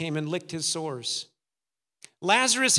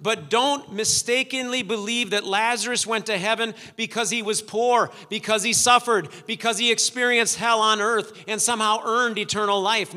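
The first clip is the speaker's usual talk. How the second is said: raised, driving preacher's delivery; emphatic preaching; 3 semitones higher, 4 dB louder than usual. Real, not acted. Preacher correcting a mistaken belief about heaven and hell, emphatic preaching. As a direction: press each point home with raised voice.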